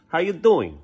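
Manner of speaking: usual speaking voice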